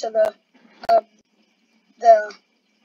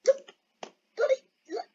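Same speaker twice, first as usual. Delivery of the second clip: alarmed; 12 semitones higher than usual. Real, not acted. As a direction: cry out in dismay. Alarmed shout.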